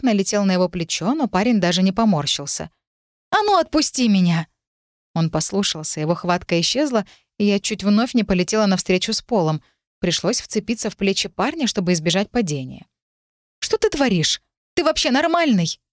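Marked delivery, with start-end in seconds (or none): 3.3-4.5 high, indignant order; high-pitched flare of temper; angry demand. A narrator's reading. Hits him, demands release. 13.6-15.8 high-pitched, outraged questions; high, exasperated scolding; indignant quarrel. A narrator's reading. Quarrel after nearly falling.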